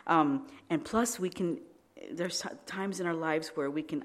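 normal talking voice

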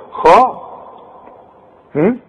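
normal talking voice

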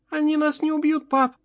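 put-on very high child's voice; small scared child asking his father; frightened boy. A narrator's reading. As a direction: pitch it far up, like a little boy.

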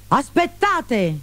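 grido acuto e allungato, non forte; grido di arresto. A narrator's reading. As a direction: ferma tutti con un richiamo alto. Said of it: "gridò Pantalone". (richiamo gridato in tono acuto)

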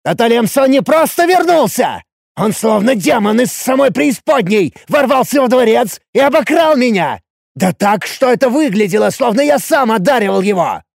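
high, agitated angry shouting; яростный рёв. A narrator's reading. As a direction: rant at the top of your voice. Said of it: "взревел я бешеным туром". (яростно, на крике)

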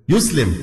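يسلم said YUS-lim, u then i; correct verb form. Shown as a demonstration. Teacher correcting the common mistake, يسلم said yuslim. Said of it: "من أين أتيتم بهذه اللغة".

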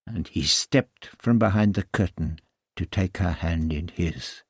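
plain reading voice

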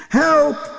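very high acted cry; screaming for help. A narrator's reading. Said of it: "Kootje schreeuwde". (hoge kreet om hulp)

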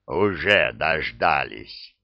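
low, slow, gloomy grumble. A narrator's reading. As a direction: grumble it darkly, drawn out. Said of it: "мрачно буркнул Адуван".

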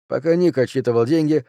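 plain reading voice

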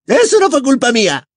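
high, loud, fast angry retort; indignant high-pitched outburst; angry self-defence. A narrator's reading. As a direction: snap back defensively in a high female voice. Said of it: "roja de ira".

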